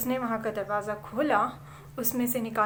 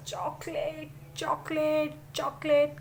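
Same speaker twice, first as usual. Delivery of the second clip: searching whisper; 4 semitones higher, slower than usual. Acted. Boy hunting for chocolate, whispering.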